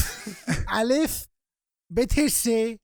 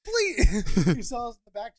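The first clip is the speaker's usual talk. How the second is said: ordinary talk, nothing marked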